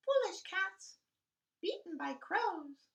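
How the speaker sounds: high, bright birdlike voice; small bird mocking the cats; chirping bird.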